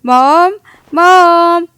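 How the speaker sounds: loud call across the house, rising on the second. Acted. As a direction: shout for mom from another room. Hungry boy calling his mother.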